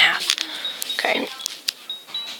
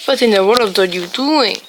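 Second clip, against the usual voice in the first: ordinary talk, nothing marked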